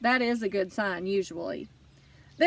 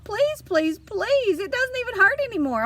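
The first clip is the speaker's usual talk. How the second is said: frantic begging; 9 semitones higher than usual. A narrator's reading. Girl begging not to cut.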